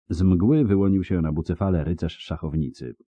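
plain reading voice

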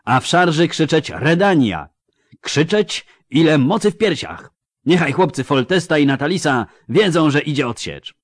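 rally the men for the charge; rallying command. A narrator's reading. General urging his cavalry to shout in the charge, rousing command. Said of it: "rozkazał, dobywając miecza".

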